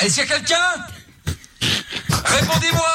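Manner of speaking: appel crié. Acted. Canular, fausse brigade au haut-parleur.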